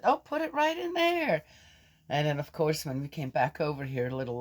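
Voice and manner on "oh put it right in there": put-on much higher, sweet coaxing voice to a child; high sweet quote. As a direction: coo it like talking to a little kid. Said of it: "so I said".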